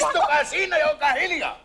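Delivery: patient snapping at the chattering visitor; angry order. Acted. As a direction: shut her up at last.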